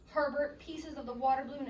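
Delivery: ordinary delivery, nothing marked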